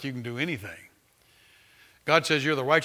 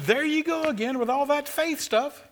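much higher, put-on voice of a scoffing doubter; mocking skeptic; 11 semitones higher than usual. Preacher mimicking a critic who scoffs at his faith talk, mock whiny voice. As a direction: mimic the eye-rolling critic. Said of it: "Somebody says".

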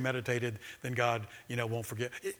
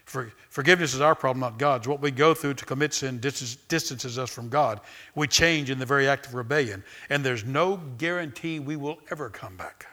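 the author's words read out louder and emphatic; quoted reading; 6 dB louder than usual. Acted. Preacher quotes the author to the congregation, louder and emphatic. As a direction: deliver the quote like a proclamation. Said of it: "He says it this way".

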